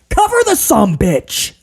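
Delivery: high mock yell at the screen; retold yelling. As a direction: yell it like an exasperated fan, held back. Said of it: "B C is yelling at this point".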